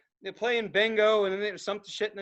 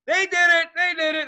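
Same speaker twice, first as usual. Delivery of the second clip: loud, high excited cheer; retold cheering; 8 semitones higher, 8 dB louder than usual. Bystander cheering the firefighters loudly. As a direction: celebrate like the rescue was a win.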